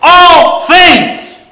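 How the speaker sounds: big, high shout, each word drawn out. Acted. Preacher shouting the verse as the Holy Spirit would, loud and emphatic. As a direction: yell it off the page. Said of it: "the Holy Spirit is shouting".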